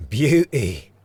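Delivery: high, loud Aussie cheer; thrilled outburst; delighted exclamation. Acted. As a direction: exclaim with gleeful relish. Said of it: "David exclaimed".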